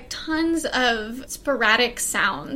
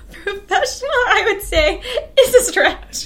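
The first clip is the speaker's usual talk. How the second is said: laughing, higher and louder; 8 semitones higher, 6 dB louder than usual. Real, not acted. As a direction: laugh it off as teasing. Mocking friend's praise with laughter.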